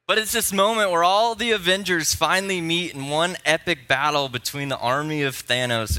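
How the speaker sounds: ordinary delivery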